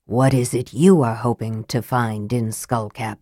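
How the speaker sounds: cool, clear words from the dark. A narrator's reading. Raistlin asks Sturm in the dark tunnel, cool and clear. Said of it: "his voice cool and clear in the darkness".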